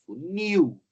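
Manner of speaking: funil with NIL high, loud and long; funil, showing off the strong final syllable; stress on last syllable. Shown as a demonstration. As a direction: hit the NIL in funil hard. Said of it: "a sílaba tônica forte é a oxítona".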